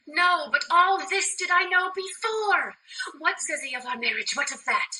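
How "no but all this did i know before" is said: raised, frustrated retort; exasperated. Acted. Juliet wanting news of the marriage, exasperated. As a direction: snap back impatiently.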